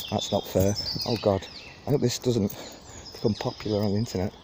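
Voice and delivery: ordinary delivery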